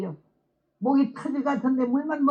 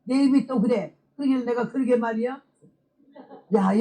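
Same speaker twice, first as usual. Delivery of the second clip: ordinary talk, nothing marked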